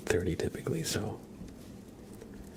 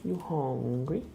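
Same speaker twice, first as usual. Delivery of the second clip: high, drawn-out rising question; cat-teasing singsong; 5 semitones higher, slower than usual. Real, not acted. Owner teasing cat about food, in high drawn-out singsong. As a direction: stretch it out in a high singsong, swooping up at the end. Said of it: "I always say it like that".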